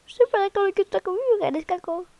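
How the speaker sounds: hammed-up frightened plea; mock begging. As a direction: plead with the neighbor not to hurt you. Begging the game neighbor.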